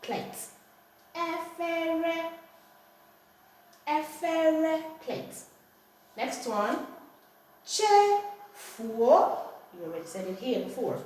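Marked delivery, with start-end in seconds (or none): 1.1-4.8 EFERE drawn out high and level; correct Igbo tones. Shown as a demonstration. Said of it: "the tones must be correct". 7.7-9.4 CHEFUO slowly in parts, high, with a rising end; Igbo tone demonstration. Shown as a demonstration. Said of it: "the tones must be correct".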